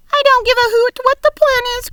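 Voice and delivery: loud, high, fast outburst; frantic cry. A narrator's reading. Earthworm crying out against the plan, high, loud and fast. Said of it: "cried the earthworm".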